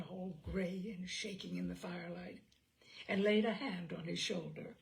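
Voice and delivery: usual speaking voice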